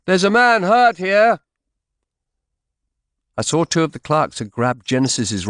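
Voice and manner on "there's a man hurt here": high, loud call for help; cried out. A narrator's reading. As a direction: shout for a physician across the crowd. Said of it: "I cried out".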